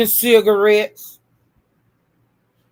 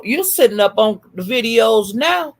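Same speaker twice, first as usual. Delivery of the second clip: ordinary delivery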